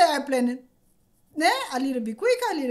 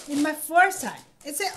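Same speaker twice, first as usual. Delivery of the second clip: ordinary delivery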